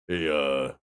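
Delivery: low, lightly voiced imitation of dad; father's deep voice. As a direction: drop into your dad's deep voice. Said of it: "Like a deep voice".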